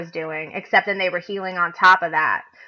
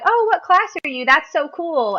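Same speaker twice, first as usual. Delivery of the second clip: much higher, bubbly admiring voice; gushing fellow player; 8 semitones higher than usual. Retold or imitated. Chat players admiring her arcanist, high and gushing. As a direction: coo like an impressed fan. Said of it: "they're just like".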